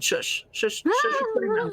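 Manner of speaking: mock-sweet shush, rising up high; playful shushing. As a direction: hush them with a teasing high voice. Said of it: "hushing a cohost playfully".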